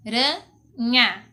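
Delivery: "aranha" broken into a, ra, nha with clear pauses; syllable by syllable. Shown as a demonstration. Teacher showing pupils how to separate "aranha", syllable by syllable. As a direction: say "aranha" very slowly, one syllable at a time. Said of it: "se a gente for separar a palavra".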